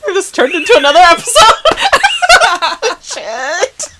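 high, fast laughing talk. Real, not acted. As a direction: burst out laughing mid-sentence. Laughing burst, high and loud.

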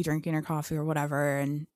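ordinary talk, nothing marked

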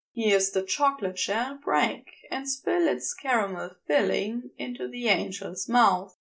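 plain reading voice

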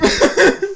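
loud, high helpless cackle; real burst of tearful laughter; laughing to tears. Real, not acted. Reader overcome by the story, loud crying laugh. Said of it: "I'm literally crying".

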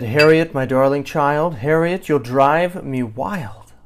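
loud, strained sweetness ending in a drawn-out wail; exasperated mother straining not to yell; mounting exasperation. A narrator's reading. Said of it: "Her mother didn't like to yell".

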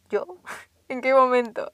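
retold reply broken by a laugh; laugh, then ask as if baffled; laughing disbelief. Woken daughter answers her mother's accusation, laughing in disbelief. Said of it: "Así me empecé a reír".

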